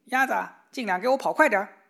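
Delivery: loud, high, fast bossy call; rooster calling out. A narrator's reading. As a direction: shout the order at the duck. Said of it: "高高地叫了一声". (公鸡高声快速地吆喝)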